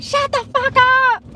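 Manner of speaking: high-pitched mock screech; imitated scream. Girlfriend telling him off in viral clip, mock screaming. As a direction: screech it, stretched out and furious. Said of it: "She screamed the first time, telling him".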